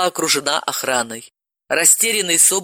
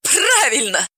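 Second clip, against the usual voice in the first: high, sharp shriek; 7 semitones higher, slower than usual. Acted. Polina shrieks at Sobolev in triumph. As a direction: yelp it out shrilly. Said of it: "взвизгнула Полина".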